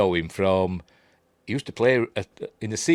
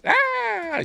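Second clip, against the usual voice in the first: mock scream. Mimicking the stabbing scene.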